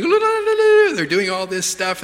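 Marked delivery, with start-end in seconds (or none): 0.0-0.9 very high, loud trilled ululation; hammed-up welcoming trill; imitated ululation. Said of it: "they're going".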